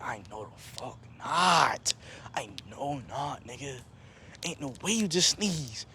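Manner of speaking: slow, drawn-out retold dismay; stunned disbelief. Teller to cousin who sneezed while hiding, slow appalled disbelief. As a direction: stretch each word in disbelief.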